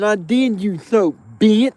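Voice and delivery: high, loud, slow taunt; taunting yell. Retold or imitated. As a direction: yell it to provoke him. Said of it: "and yelled that shit real loud".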